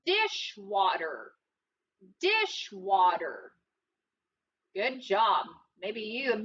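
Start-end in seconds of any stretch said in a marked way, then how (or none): 0.1-1.3 Dishwater drawn out slowly and low; slow, low model. Shown as a demonstration. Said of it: "What's the word?". 2.2-3.5 teacher echoing Dishwater slowly; slow repeat. Shown as a demonstration. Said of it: "What's the word?".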